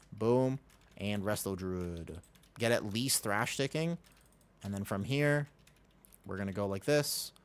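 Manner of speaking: ordinary delivery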